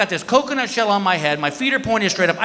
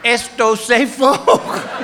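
mock Spanish tourist; 4 semitones higher, much slower than usual. Tourist asking the guide if ziplining is safe, slow fake Spanish. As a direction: stretch each word like bad Spanish. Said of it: "I go".

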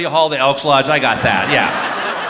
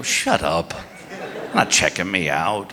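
bashful denial; 10 semitones lower, 5 dB quieter, slower than usual. Retold or imitated. Bashfully denying to his friend.

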